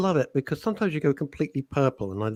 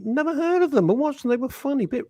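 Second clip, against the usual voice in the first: high mock voice; 11 semitones higher, 4 dB louder than usual. Quoting a listener.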